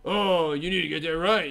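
a smug know-it-all; arrogant correction. Retold or imitated. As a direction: lecture them from above. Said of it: "God isn't arrogant".

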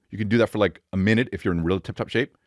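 normal talking voice

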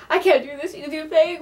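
laughing. Real, not acted. Laughing at her blunder.

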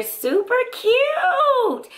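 slow, very high squeal of delight; gushing. Real, not acted. Gushing to viewers over the new lipstick, slow and very high. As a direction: stretch it out high like you're melting over it.

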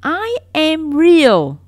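very loud, high, slow plea; cling to each word, pleading; desperate insistence. Acted. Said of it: "said the little rabbit".

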